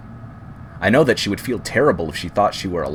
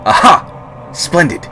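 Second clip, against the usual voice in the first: much higher and louder, bursting with joy; delighted discovery; 9 semitones higher, 9 dB louder, much slower than usual. Acted. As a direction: cry out in sudden delight at an answer. Discovers a response knocking back.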